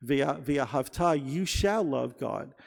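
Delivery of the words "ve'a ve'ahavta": "ve'ahavta" slowly, as ve-a-hav-TA; stress on last syllable. Shown as a demonstration. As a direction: land on the final TA of "ve'ahavta". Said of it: "accent is on the last syllable".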